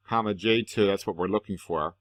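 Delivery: ordinary talk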